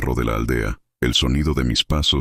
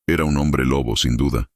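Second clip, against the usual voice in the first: ordinary delivery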